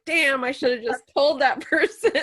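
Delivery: imitating someone kicking themselves, laughing; mock regret. Host teasing the medium about her stomach trouble, laughing mock regret. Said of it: "sitting there with digestive issues going like".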